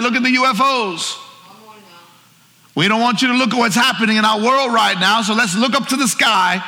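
high-pitched, mocking pitchman; mock voice of the enemy. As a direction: push the distraction like a hammed-up huckster. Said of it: "exactly what the enemy would want".

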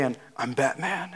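a hint of brooding Batman. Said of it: "he's just like".

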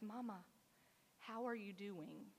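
put-on a hushed toddler's question; soft child's whisper. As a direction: ask it very softly, like a small child. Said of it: "it sounded like a whisper".

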